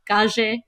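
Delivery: calle with the double L as a J, "ca-jay"; local Gibraltar pronunciation. Shown as a demonstration. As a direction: say calle with an English J in the middle. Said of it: "pronounced more like a J here".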